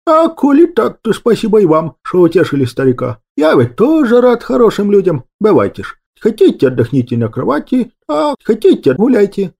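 a hint of a chatty old granddad; old grandfather. A narrator's reading. Grandfather sending the young guests off, lightly voiced old man.

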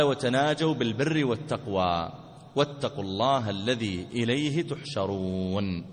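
low, slow measured recitation; slow recital with lengthened endings; scripture recitation. Acted. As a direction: draw out the verse, long final vowel.

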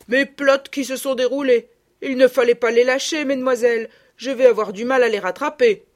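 loud rustic gamekeeper, annoyed. A narrator's reading. Gamekeeper scolding the young ladies, loud and rustic. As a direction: grumble loudly like a country keeper.

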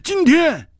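high, loud outburst; high-pitched voiced character; high exclamation. A narrator's reading. Cry of disbelief in quarrel.

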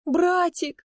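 high, drawn-out wail; forced tearful cry. Pretend. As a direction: squeeze out fake tears, crying out high. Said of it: "выдавливая слёзы". (притворно плаксивый высокий возглас)

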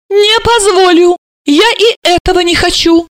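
high-pitched, emphatic outrage; hysterical refusal. A narrator's reading. Mother refusing a grandchild to her daughter, hysterical.

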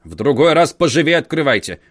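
grumbling. A narrator's reading. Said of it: "буркнул дядя".

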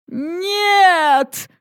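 high anguished cry of Нет; desperate roar. A narrator's reading. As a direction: roar it out in despair. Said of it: "Рык Дара был отчаянным".

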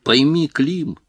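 a mild pitiful moan of a plea; whining plea. A narrator's reading. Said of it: "жалобно ныл".

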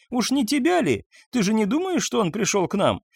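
высоко, игриво дразня; подтрунивает над мужем, смеясь голосом; насмешливо весело. A narrator's reading. Марта поддразнивает мужа со смехом. (Марта дразнит мужа, высоко и весело)

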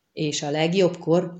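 plain reading voice

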